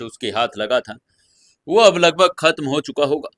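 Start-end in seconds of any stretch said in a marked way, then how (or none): none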